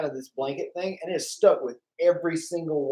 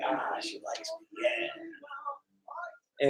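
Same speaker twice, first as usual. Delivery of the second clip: dreamy smug; 11 semitones higher, 12 dB quieter than usual. Retold or imitated. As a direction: savor it like a crush. Retelling his own thought.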